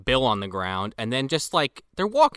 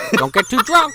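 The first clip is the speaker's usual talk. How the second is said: mock announcer; 13 semitones higher, 4 dB louder than usual.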